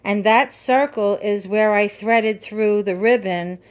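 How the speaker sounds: ordinary delivery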